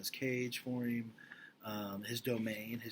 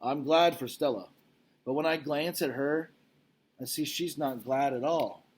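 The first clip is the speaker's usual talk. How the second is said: louder, expressive storybook reading; reading aloud to children; 4 semitones higher, 9 dB louder than usual. Acted. Teacher reading the gorilla novel to her class, expressive. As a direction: read the chapter like a gripping story.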